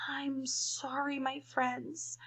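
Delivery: a sad, gentle apology. Acted. The elephant apologizes to friends, softly sad. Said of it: "said the elephant sadly".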